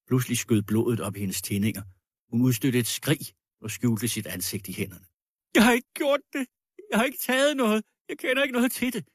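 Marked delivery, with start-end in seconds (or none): 5.5-9.0 very high, desperate wail; heartrending cry. A narrator's reading. Accused girl denying the theft, crying out. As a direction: plead innocence at the top of the voice. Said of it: "udbrød hun med hjerteskærende gråd".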